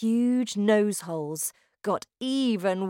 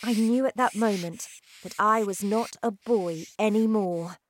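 ordinary delivery, nothing marked

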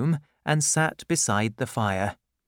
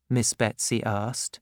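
plain reading voice

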